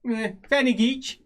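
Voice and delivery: high, drawn-out haggling voice; name a price as a small pie seller; halfling merchant. Acted. Halfling sizing up a customer, high sales pitch. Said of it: "looks at you".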